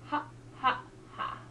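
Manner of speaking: unvoiced ha, ha, ha panted out; breathy ha ha ha. Shown as a demonstration. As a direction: breathe out ha, ha, ha in short puffs. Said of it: "repeat after me".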